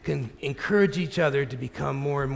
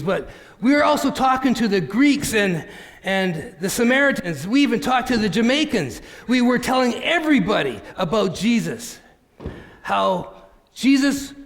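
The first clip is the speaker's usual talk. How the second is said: louder, higher, eager retelling; animated storyteller, emphatic and raised in pitch; excited testimony; 5 semitones higher, 4 dB louder than usual. Acted. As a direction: tell it with rising zeal, loud and high. Acted Barnabas recounting his preaching.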